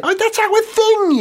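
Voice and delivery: high, slow indignant whine; upset carmaker. Pretend. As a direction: whine like a wounded rival. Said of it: "you'd say".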